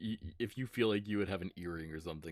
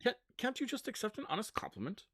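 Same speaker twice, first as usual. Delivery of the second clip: high-pitched, affronted protest; put-upon, higher-voiced indignation; mock wounded; 12 semitones higher, 3 dB quieter than usual. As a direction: plead as if slighted by a friend. GM's character acts wounded by doubt.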